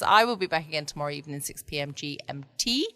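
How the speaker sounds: normal talking voice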